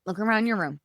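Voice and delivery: ordinary delivery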